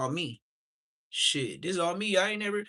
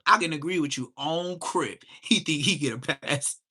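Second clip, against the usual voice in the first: usual speaking voice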